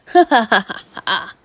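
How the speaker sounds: loud mock villain laugh; hammed-up boyfriend's evil laugh; gloating laugh. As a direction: burst into a smug fake cackle, then drop. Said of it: "and you're like".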